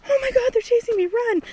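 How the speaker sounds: high, hammed-up panicked cry; retold panic. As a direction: sound like bugs are chasing you. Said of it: "he's like".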